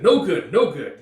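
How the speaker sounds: loud high scolding; harsh coaching shout. Acted. Gambino correcting Guts in training, loud scolding. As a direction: bark the correction.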